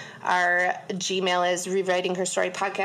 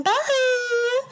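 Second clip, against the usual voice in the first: high, long drawn-out sorority bye; aggressive bye; 13 semitones higher, 3 dB louder than usual. Retold or imitated. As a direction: stretch it out like an overdone sorority girl. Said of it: "Oh, it's so aggressive".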